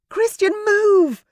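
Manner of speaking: high, loud scream, drawn out slowly; screamed warning. A narrator's reading. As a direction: scream his name to save him. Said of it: "Rosamond screamed".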